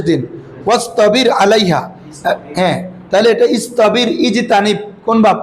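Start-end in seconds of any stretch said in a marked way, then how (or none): none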